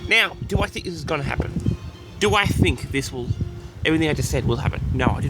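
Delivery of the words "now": ordinary talk, nothing marked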